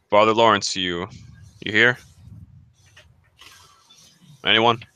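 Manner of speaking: hailing the father in an echoing hall; calling out. Acted. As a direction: call out to find the priest. Calling out in empty church.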